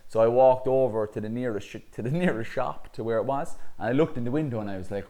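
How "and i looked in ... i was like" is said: ordinary talk, nothing marked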